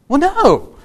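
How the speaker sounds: high, loud, obvious no; emphatic denial. Acted. Pastor answers are you a heifer, emphatic mock-child denial. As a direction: fling it out as if it's absurd.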